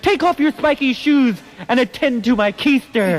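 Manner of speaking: poem recitation. Acted. Host resumes the Christmas parody after an interruption, poem recitation. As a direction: declaim it as campy holiday rhyme.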